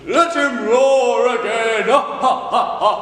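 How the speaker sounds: loud, slow sing-song roar. Acted. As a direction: bellow it like a lion. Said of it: "make the Duke say".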